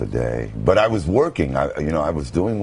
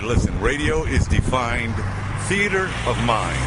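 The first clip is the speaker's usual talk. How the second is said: radio voice; 9 semitones higher than usual. Retold or imitated. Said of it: "He said to me".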